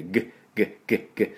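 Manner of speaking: グッ as short throat clicks without a full vowel; throaty G. Shown as a demonstration. Teacher drilling the G of bag, short throaty グッ. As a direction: sound グッ in the throat, teeth apart. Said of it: "喉をグッって鳴らすだけ".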